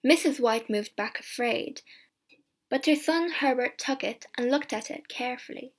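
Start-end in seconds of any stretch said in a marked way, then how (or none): none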